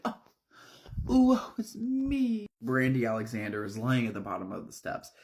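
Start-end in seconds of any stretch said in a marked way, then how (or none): 0.1-2.5 high, drawn-out theatrical wail; hammed-up tearful self-pity, loud and slow; mock crying. Evie crying at the top of the stairs, hammed-up wailing. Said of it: "she's crying, and she's like".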